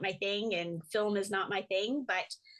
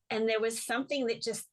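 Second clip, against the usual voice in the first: normal talking voice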